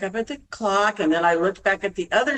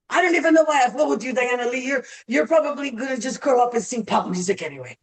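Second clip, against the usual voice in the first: frustrated teacher; 5 semitones higher, faster than usual. Retold or imitated. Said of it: "he would say".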